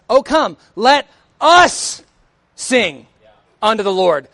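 slow emphatic "us" in "Oh, come, let us sing"; stress on US. Shown as a demonstration. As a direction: hit "us" hard and slow in "let us". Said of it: "if we use stress the right way".

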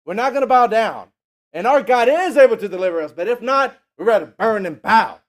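loud, firm refusal to the king; bold defiance. Retold or imitated. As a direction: stand your ground before the king. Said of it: "He said".

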